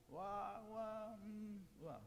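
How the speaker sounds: soft, badly faked wailing; half-hearted pretend "wah" sobs; mock crying. Narrator acting the bluebird's tears, mock soft wail. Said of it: "could not stop crying".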